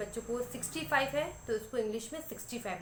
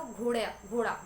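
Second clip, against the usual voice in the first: loud, clear घोड़ा said twice; emphatic word for child; 4 dB louder, slower than usual. Shown as a demonstration. Mother prompting her child with the word घोड़ा. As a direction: call out घोड़ा firmly to the child. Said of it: "बच्चों को ये बोलो".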